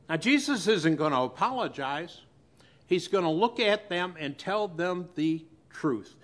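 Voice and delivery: ordinary delivery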